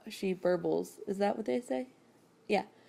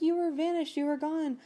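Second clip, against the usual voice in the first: higher-pitched mock freak-out; retold freak-out, pitched up high; mini freak out; 10 semitones higher than usual. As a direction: act out a mild, incredulous panic. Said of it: "this mini freak out".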